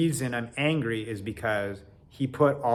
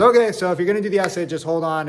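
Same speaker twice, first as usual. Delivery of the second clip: professor calling out; 5 semitones higher, 6 dB louder, faster than usual. Retold or imitated. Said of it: "so the professor goes".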